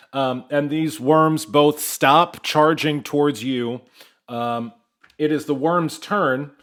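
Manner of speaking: ordinary delivery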